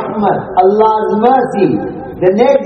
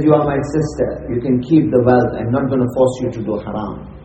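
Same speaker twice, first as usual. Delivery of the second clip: low, softened voice, falling away; gentle reassurance; 7 semitones lower, 5 dB quieter than usual. Acted. Kifl releases the distressed woman as his sister, gently reassuring. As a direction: reassure her quietly, pressing nothing on her.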